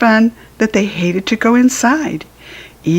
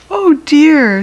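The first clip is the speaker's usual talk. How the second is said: puzzled; 7 semitones higher, 4 dB louder than usual. A narrator's reading. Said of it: "said the puzzled puppies".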